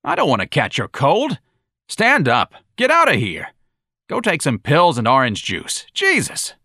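loud, high shouted orders; barked order. Acted. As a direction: bark him out of the room. Sergeant keeps shouting.

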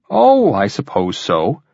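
slow, offhand concession; mildly feigned lack of interest; indifferent. A narrator's reading. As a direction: shrug it off, drawing out the words. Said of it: "he replied indifferently".

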